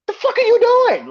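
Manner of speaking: loud high outraged yell. Real, not acted. Fan yelling at the bookers, outraged. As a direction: shout it in disbelief at the bookers.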